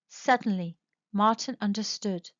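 ordinary delivery, nothing marked

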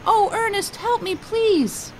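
high, desperate cry, slow at the end; yelling for help. A narrator's reading. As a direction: scream for rescue from the raft. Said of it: "waving his arms and yelling".

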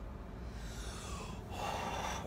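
long, soft breathy exhale; slow sigh to settle nerves; nervous sigh. Real, not acted. Nervous, waiting before going in.

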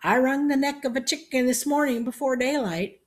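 put-on high, loud old-man voice; stronger voice. A narrator's reading. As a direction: boast like a spry old-timer. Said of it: "said in a stronger voice".

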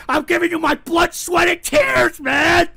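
whiny, martyred outburst; wounded plea. Real, not acted.

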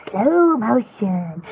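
slow, low slowed-down recording voice; imitated slow motion. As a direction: drag it out deep, mimicking slow-motion playback. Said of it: "like, a little slow motion".

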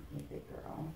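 quiet loving reassurance. Real, not acted. Praising monkey during exam.

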